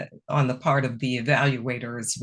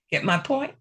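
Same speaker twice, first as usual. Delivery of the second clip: ordinary talk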